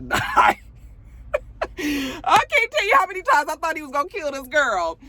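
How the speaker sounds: high, laughing voice; high-pitched amused squeal carried through the words. Real, not acted. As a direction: break up laughing while talking. Cracks up over the story.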